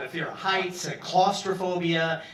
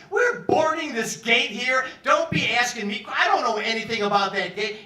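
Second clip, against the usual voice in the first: higher, louder mock yell from a gate agent; retold screaming; 7 semitones higher, 5 dB louder than usual. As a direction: snap back like an annoyed gate agent. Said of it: "started screaming at me".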